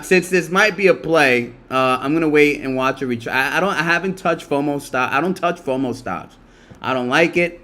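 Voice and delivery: ordinary delivery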